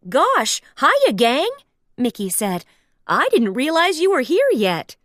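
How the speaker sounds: high, loud cheery Mickey voice. Acted. Mickey greets friends at his party. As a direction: greet the gang brightly.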